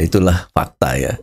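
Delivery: ordinary delivery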